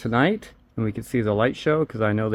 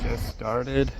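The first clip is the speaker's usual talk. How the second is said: yawning; 5 dB quieter than usual. Real, not acted. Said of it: "She's already yawning".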